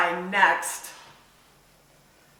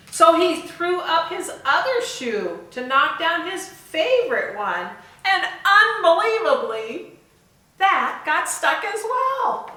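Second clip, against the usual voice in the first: climbing higher and higher in amazement; rising disbelief; 9 semitones higher, 4 dB louder than usual. Acted. Teacher telling kids the shoe got stuck, rising amazement. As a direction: build the disbelief as it piles up.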